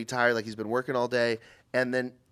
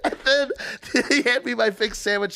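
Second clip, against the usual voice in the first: usual speaking voice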